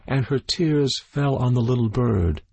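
ordinary delivery

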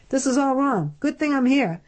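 put-on a know-it-all kid fixing your mess; fussy younger brother. A narrator's reading.

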